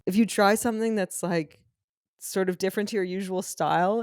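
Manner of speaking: ordinary talk, nothing marked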